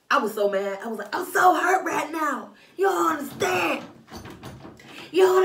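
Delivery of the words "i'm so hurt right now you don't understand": usual speaking voice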